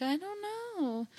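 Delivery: high, soft feigned innocence; a breathy little clueless "I don't know"; playing dumb. Wife playing dumb with her husband, high and soft. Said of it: "playing dumb somehow".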